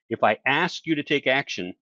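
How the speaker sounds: normal talking voice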